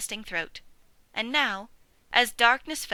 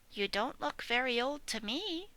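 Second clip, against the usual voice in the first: polite; 3 semitones higher, 8 dB quieter than usual. A narrator's reading. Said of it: "said Babe politely".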